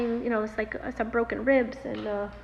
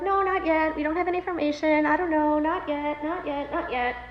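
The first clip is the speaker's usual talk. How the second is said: tag; 4 semitones higher than usual. Retold or imitated. Staff fending off repeated questions.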